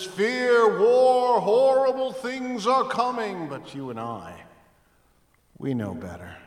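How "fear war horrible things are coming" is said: high, loud alarmed doomsaying; doom-mongering world. Retold or imitated. Pastor voicing the fearful world, high and alarmed. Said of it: "The world looks around and says".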